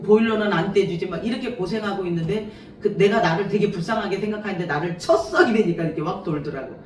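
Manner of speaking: usual speaking voice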